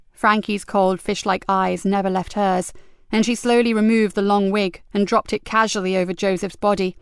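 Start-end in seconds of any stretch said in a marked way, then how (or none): none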